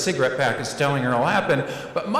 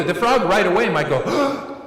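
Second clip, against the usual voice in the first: ordinary talk, nothing marked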